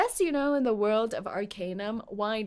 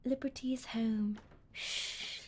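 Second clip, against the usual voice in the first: whisper; 7 dB quieter, slower than usual. Acted. Character whispers at home.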